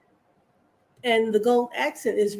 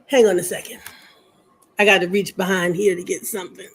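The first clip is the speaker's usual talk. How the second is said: ordinary talk, nothing marked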